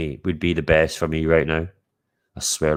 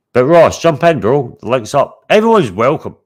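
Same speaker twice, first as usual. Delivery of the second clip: loud invitation; 6 semitones higher, 8 dB louder than usual. Real, not acted. Inviting people onto the call.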